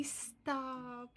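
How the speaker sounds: ordinary talk, nothing marked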